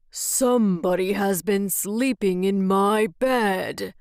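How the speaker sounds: growling Father Bear; great big growly voice. A narrator's reading. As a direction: rumble it out like a huge gruff bear. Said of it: "said in his great, big, growly voice".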